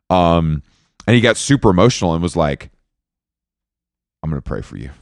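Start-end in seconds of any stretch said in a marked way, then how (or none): 4.2-4.9 much softer, low and heartfelt; sincere. Retold or imitated. Quoting the tearful driver's promise, softly and earnestly. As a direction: hush it like a promise.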